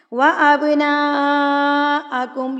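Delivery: وَأَبْنَاءَكُمْ with the long a held slowly; long madd. Shown as a demonstration. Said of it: "یہاں پر بھی بڑی مد ہے". (وَأَبْنَاءَكُمْ میں مد متصل کھینچیں)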